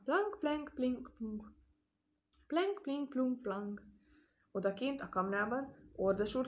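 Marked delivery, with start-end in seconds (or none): 0.0-3.8 ping out mixed fiddle notes; vocal pizzicato, each group high then falling; imitated string plucking. A narrator's reading. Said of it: "magasat váltogatva".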